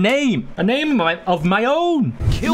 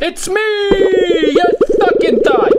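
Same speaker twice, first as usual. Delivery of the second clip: gloating taunt; 8 semitones higher, 4 dB louder, much slower than usual. Pretend. Gloating after winning.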